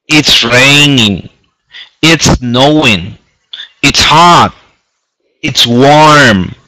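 teacher modelling each phrase slowly; slow model reading. Shown as a demonstration. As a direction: say each weather phrase slowly and clearly for students to copy.

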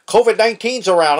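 mocking someone who dodges a hug in fear; mock fearful brush-off. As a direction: imitate a jumpy germ-scared person. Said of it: "Some people are like".

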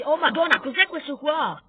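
loud, high burst of alarm; startled exclamation. Real, not acted. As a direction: blurt it out at a sudden monster. Startled by a monster in game.

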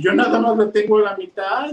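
hammed-up self-pity, rising high; tearful complaint. A narrator's reading. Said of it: "¿por qué estás llorando?".

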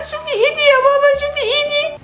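very high, sobbing plea to mama; shrieky high crying; wailing in pain. Acted. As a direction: cry hard and show mama the hurt. Crying child shows mother burnt hand.

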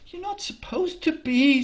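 put-on a young girl correcting him lightly; Janie's voice. Janie tells Jakob not to be sad, high girl voice. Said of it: "she said".

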